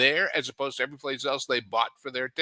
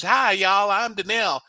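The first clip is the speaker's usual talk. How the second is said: lightly imitated Southern accent, a bit higher; 6 semitones higher, 3 dB louder than usual. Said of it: "thick Southern accent".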